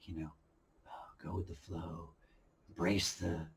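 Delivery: calming voice. Retold or imitated. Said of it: "trying to calm down".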